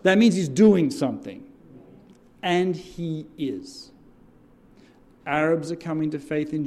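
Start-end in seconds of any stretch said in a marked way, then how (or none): 0.0-3.9 louder rising preacher's call, rallying the crowd; preaching at full force. Acted. Pastor rallies the congregation with call and response, preaching loud. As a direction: drive each line home and wait for the yes.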